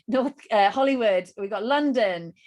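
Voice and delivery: usual speaking voice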